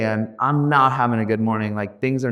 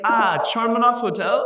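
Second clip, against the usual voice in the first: much higher questioning Indian English; Indian auto driver; 11 semitones higher than usual. Retold or imitated. Indian auto driver to speaker, puzzled accent. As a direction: mishear a curse as a hotel name. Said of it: "And he's like".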